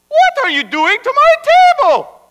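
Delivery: high, loud outraged wife; indignant demand. Acted. Wife finding her table being sawed, demanding. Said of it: "demanded Esther on the Friday morning".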